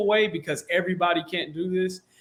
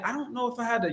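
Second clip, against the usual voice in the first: higher, softer, unassuming; softly puzzled, pitched up; curious, confused; 6 semitones higher, 6 dB quieter, faster than usual. Shown as a demonstration. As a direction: sound a little lost and unsure. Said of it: "or curious or confused a little bit".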